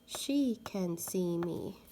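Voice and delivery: she can see me read slowly and evenly; slow clear trial reading; trying out a sentence. Shown as a demonstration. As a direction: read the fitting sentence carefully. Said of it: "Let's try the word she".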